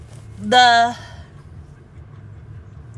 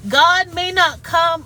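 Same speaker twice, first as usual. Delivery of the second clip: getting emotional; 6 semitones higher, 5 dB louder, slower than usual. Real, not acted. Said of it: "Oh, I'm getting emotional".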